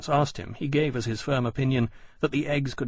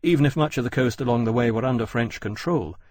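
usual speaking voice

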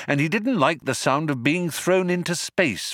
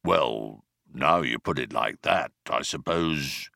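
very low, softer bemused rumble; bemused rumbling; 9 semitones lower, 4 dB quieter than usual. Acted. Vogon guard mulling Ford's point, deep bemused rumbling. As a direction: rumble it out deep, half persuaded. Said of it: "Deep rumblings of bemusement".

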